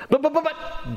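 high, hurried buts; stammering protest. Acted. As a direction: sputter out excuses like a cornered man. Moses stammering excuses to God.

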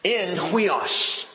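"huios" said as HWEE-os in Greek; Greek word huios. Shown as a demonstration. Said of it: "It is written like this".

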